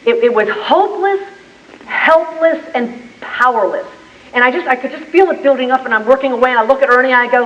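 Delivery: ordinary delivery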